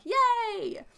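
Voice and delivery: put-on a kid getting chocolate; delighted cheer.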